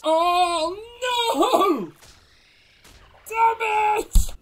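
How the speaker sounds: high, loud despairing wail; anguished cry. Real, not acted. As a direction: cry out as all is lost. Dino dies in game.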